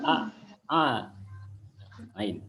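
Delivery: "A" squeezed from mid-throat; proper ain. Shown as a demonstration. Teacher correcting a pupil, ain from mid-throat. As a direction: make "A" and "Ain" start in the throat without NG. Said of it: "Awas, ain, bukan nga ya".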